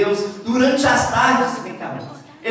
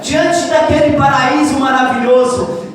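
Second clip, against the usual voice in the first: loud, ringing preacher's call over music; full-force preaching; 3 semitones higher, 5 dB louder than usual. Acted. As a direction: preach it out to the whole camp.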